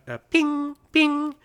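high, thin sonar ping; ping like a tiny radar; high-pitched ping. Retold or imitated. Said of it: "a very high-pitched sound".

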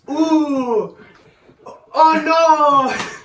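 high, loud, drawn-out mock wail; exaggerated childish "scared" mockery. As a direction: taunt like you're teasing a six-year-old. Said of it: "mocking me like I'm six".

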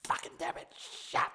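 whispered string of curses, voiceless; under my breath. Shown as a demonstration. Showing how he keeps his temper in, whispered curses under breath. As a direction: swear hissed under the breath to keep the temper in. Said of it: "under my breath".

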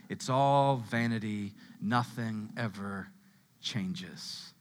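slow, low, world-weary recitation; nihilistic resignation. Acted. Preacher voicing King Solomon's emptiness, slow and resigned. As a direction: drag it out quietly, as if nothing matters. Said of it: "half Buddhist, half nihilist on us here".

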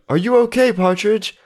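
high, concerned call. Acted. Friends to man fallen in pit, calling down. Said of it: "call down and they're like".

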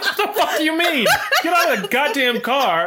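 high-pitched, incredulous mock outburst; exasperated boss. Crime boss orders useless recruits from the car, exasperated.

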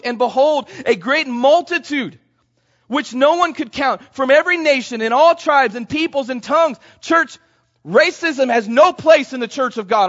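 high, loud fired-up preaching; rally the church with forceful, emphatic cries; ateşli vaaz. Real, not acted.

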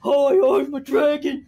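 slow, loud mock dragon voice; silly dragon voice. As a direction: puppet the statue like a toy. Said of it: "makes it talk".

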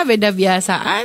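ordinary delivery

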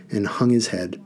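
ordinary delivery, nothing marked

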